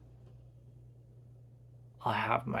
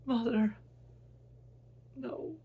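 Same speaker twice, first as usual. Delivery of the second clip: higher pleading wail; higher broken plea; desperate cry; 11 semitones higher than usual. Acted. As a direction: call after her in anguish. Son cries over dead mother.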